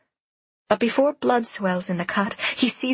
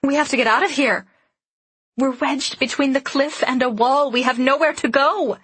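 frightened urgency; 4 semitones higher, 3 dB louder than usual. Acted. Trapped in carriage under attack.

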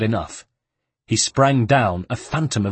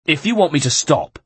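a loud, threatening guard shout; 6 semitones higher, 4 dB louder than usual. A narrator's reading. The guard shouts at the monk while kicking him, loud. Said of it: "shouted the guard".

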